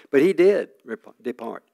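ordinary talk

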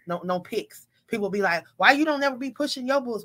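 usual speaking voice

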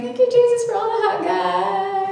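high, half-sung gushing praise; giddy gushing. Retold or imitated. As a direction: gush like a giddy girl at worship. Giddy worship quote.

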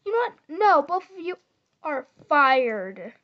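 loud angry firing; angry boss. Pretend. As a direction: fire them like an angry boss. Boss firing arguing players.

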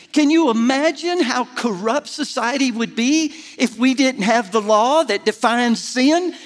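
rising pulpit fervour; preaching at full force. Acted. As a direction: lift the room with fervour. Preaching climax on the law.

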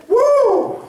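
high whooping cry of delight; excited whoop. Retold or imitated. Preacher voicing Adam seeing Eve, high whoop. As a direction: whoop like a man seeing his bride.

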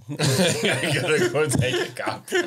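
slow, laughing repeat; cracking up while echoing the joke. Real, not acted. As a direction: say it through real laughter.

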